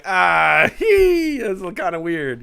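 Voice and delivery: imitating a cult member finding something weird, hammed-up and giggly; mock cult member. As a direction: ham up a giddy cultist, slow on the last words. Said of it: "a cult would look at that and be like".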